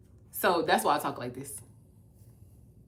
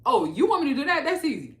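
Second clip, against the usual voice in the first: mock cocky; 8 semitones higher, 6 dB louder, faster than usual. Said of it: "I lost my aggression and my intentionality in saying".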